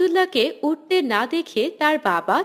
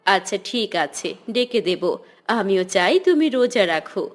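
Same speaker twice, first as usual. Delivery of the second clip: a hint of a concerned mother, pitched lower; mother gently cautioning her son, lightly voiced lower; 8 semitones lower than usual.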